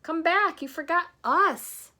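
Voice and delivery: high, loud yell after him. Acted. Santa and Missus Forgetful yelling at Grandpa to come back. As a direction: yell after the departing car. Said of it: "yelled until Grandpa came back".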